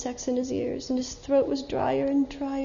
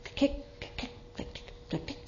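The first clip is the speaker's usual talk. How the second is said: soft, unvoiced clicks, far down the road; distant clicking; 4 semitones higher, 11 dB quieter, faster than usual. A narrator's reading. Narrator voicing hooves far off, soft distant clicks. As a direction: whisper the clicks as if heard from afar. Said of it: "he heard a sound far off".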